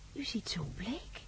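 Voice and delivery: slow, hushed whisper of concern. Acted. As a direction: lean in and breathe it softly. Librarian fussing over pale man.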